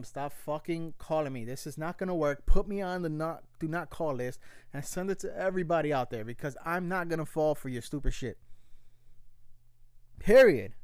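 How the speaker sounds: telling off the scam caller, building to a loud final "Period"; fed-up warning that swells into a loud blast; angry tell-off. Retold or imitated. To scam callers who keep phoning: an angry tell-off.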